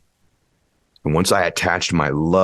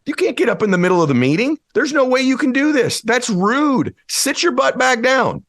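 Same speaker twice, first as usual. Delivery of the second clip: mock stern scolding. Someone stopping you at the meeting door: high mock scolding. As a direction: bark like a fussy rule-keeper blocking the exit. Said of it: "you go".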